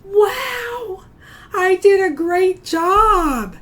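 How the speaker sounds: high, slow, delighted wow; loud, delighted self-praise demo; excited praise. Shown as a demonstration. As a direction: model a proud wow for the kids. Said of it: "look down at your paper and say".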